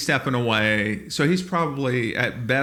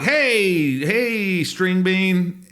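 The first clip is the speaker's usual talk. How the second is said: high, loud teasing call; carnival barker heckle; 8 semitones higher, 4 dB louder, much slower than usual. Retold or imitated. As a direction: rib a skinny passerby. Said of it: "We were literally carnival barkers".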